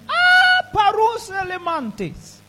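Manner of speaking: very high, loud, drawn-out cry; shouted tongues. Real, not acted. Preacher shouting in tongues, high and slow. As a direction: let it burst out in tongues.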